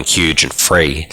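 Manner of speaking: plain reading voice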